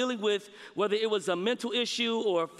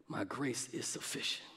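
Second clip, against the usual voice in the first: much softer and lower, almost hushed; 11 semitones lower, 12 dB quieter than usual. Acted. Preacher voicing God's answer to Paul, hushed and low.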